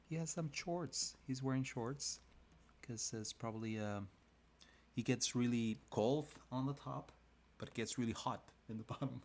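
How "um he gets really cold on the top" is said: normal talking voice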